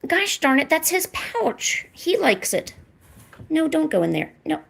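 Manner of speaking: ordinary talk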